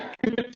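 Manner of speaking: quick high giggle; a burst of amused laughter; short laugh. Real, not acted. Delighted by the bar's texture.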